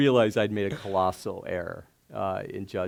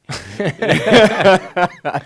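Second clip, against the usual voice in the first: high, loud burst of laughter; 11 semitones higher, 16 dB louder than usual. Real, not acted. Recalling the Pumpkinhead name, laughing loudly. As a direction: crack up at the silly name.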